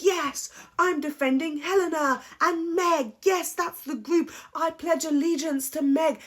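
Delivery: high, sing-song sucking-up voice; mock her as a keen loyal groupie; mocking eager follower. Vlogger mocking Angel as Meg's eager loyal follower, high sing-song. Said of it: "she just wanted to act like".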